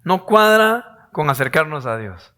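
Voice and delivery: ordinary delivery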